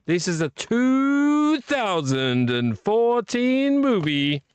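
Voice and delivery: high, slow movie trailer announcer; dramatic trailer voice. Pretend.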